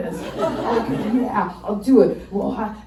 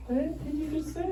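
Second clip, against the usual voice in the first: high, soft shocked question; shocked hush; 7 semitones higher, 9 dB quieter than usual. Retold or imitated. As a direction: ask it stunned. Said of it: "I'm like".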